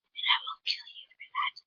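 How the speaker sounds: creepy whispered threat, then take it back; playful murderous whisper; mock death threat. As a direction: whisper it like a joking menace. Mock threat, then "I'm kidding.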